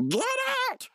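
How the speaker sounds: mock furious boss yell; Benson. Boss firing a prankster, mock angry yell. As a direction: throw him out in a put-on cartoon shout. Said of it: "then Benson says".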